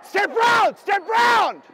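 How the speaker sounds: high, loud urgent coaching; real ringside holler; cornerman's shout. Real, not acted. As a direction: yell instructions and approval to a fighter. Coach shouting instructions in fight.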